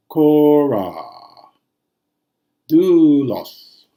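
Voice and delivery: Kora and Doulos called out loud with long vowels; example words stressed. Shown as a demonstration. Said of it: "or a diphthong".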